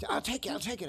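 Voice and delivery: high, hurried mock-panicked pleading; imitating panicked kid. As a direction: mimic a scared kid giving in. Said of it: "I thought the kid was gonna pass out".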